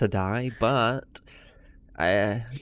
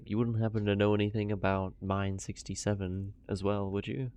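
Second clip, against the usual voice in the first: lightly hushed, discreet question; slightly hushed tone; 5 dB quieter than usual. Acted. Player quietly asking the barkeep about the mine, hushed. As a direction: keep it a little hushed. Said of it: "just a little hushed tone".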